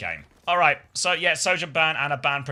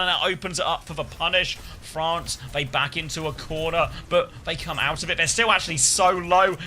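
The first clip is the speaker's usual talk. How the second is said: excited play-by-play; 6 semitones higher, 5 dB louder than usual. Acted. Live play-by-play.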